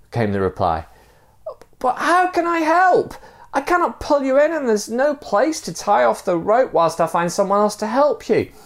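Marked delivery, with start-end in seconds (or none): 1.8-8.5 high acted calling over a distance; helpless shout. Man shouts helplessly down to stranger.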